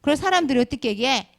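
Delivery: usual speaking voice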